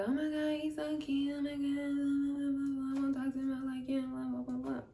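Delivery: mock gushing in a high, soft sing-on monotone; gushing friend. Retells friend gushing over brother.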